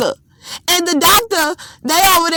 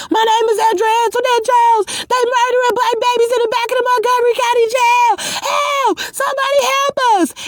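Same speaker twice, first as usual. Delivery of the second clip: much higher desperate screaming, fast; screaming for help; 8 semitones higher, faster than usual. Retold or imitated. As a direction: shriek it out like you're being dragged away. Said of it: "I was screaming".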